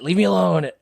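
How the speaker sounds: high, mock-annoyed kid whining at mom; angry kid. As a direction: complain up at mom like a peeved kid. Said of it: "an angry kid yelling at his mom".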